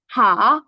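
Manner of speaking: হা drawn out, loud and clear; careful pronunciation. Shown as a demonstration. Teacher modelling হা for beginners, careful pronunciation. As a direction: sound out হা fully for learners. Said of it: "আমরা উচ্চারণটা ঠিকভাবে বলবো".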